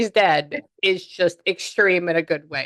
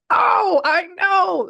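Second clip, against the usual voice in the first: high, loud shouted agreement; match their bigness with a high shout; big exclamation; 7 dB louder, much slower than usual. Retold or imitated. Medium answering the excited spirit, big exclamation. Acting out her big reply.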